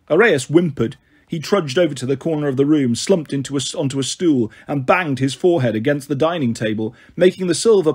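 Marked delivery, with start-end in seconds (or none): none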